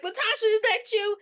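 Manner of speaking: high hopeful question; mock hopeful high question; lightly voiced Boo. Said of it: "Boo's like".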